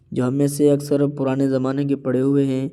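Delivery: normal talking voice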